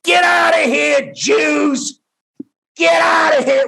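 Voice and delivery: high, slow mock shout of a child; little girl yelling. Comedian imitating the screaming girl from the film, high shout. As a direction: yell like a small angry girl on a mailbox. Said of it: "standing on a mailbox going".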